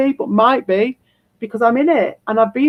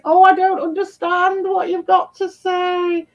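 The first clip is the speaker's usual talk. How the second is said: higher, slow, dopey sneering voice; mocking impression; 6 semitones higher than usual. Mocking the troll's reply to his chat, slow and dopey. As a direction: parody the troll as slow and dim. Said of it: "All he can say is".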